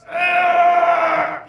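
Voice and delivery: high loud labor scream; mock birthing shriek; straining scream. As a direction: belt out a pushing scream. Wife pushing in labor.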